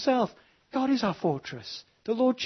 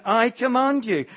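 raised, loud "I"; stress on I; 7 semitones higher, 6 dB louder than usual. Shown as a demonstration. God commanding the prophet, strong stress on I. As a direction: declare it with weight on "I". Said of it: "the emphasis is on God".

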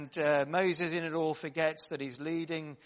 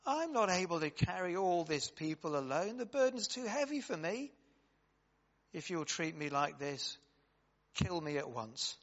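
impassioned scripture reading; 2 semitones higher, 4 dB louder than usual. Acted. Reads Moses' complaint aloud.

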